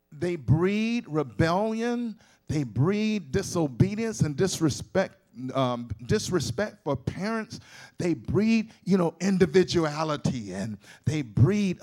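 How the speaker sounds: slow, loud, hammering preacher delivery; preaching at full force. Acted. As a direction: pound each "breed" to the congregation. Emphatic preaching against schools.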